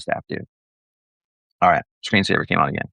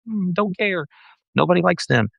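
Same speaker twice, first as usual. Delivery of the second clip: high-pitched, airy brush-off; mock dismissive; 10 semitones higher than usual. Pastor shrugging off a candidate's résumé, mock dismissive. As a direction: wave it away in a raised voice. Scornful answer to the impressed quote.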